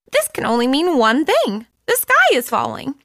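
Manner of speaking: a frantic little chick sure of doom; panicky chick voice. Acted. Chicken Little alone, convinced the sky falls, a high panicky squeal. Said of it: "he shouted".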